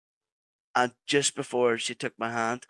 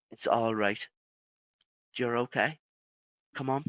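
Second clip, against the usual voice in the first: soft, gentle reassurance, quieter; calming voice; 5 dB quieter than usual. Retold or imitated. Woman in the light calming the frightened drowning man, softly. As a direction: mildly soothe someone who is frightened. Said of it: "and calming voice".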